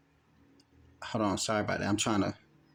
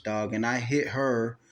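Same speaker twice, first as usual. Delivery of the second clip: ordinary talk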